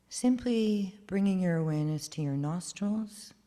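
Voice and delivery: guided meditation. Acted. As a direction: guide breathing calmly and gently.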